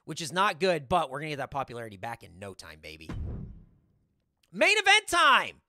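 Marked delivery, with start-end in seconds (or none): 4.5-5.6 announcer call. Acted. Host announces the main event.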